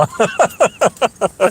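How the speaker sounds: high chuckling laugh; amused laughter after the joke; real laugh. Real, not acted. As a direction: laugh in a falling run of chuckles. Laughs at own retold reaction.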